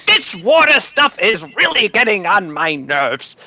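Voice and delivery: high, loud put-on bird voice; exasperated bird complaining at high pitch; irritated bird character. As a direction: squawk it out, fed up.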